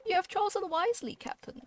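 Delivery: a high, lightly voiced courtly lady; pleased seneschal. Seneschal speaks in game text.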